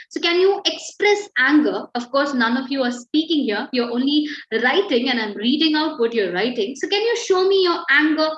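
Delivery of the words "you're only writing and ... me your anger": normal talking voice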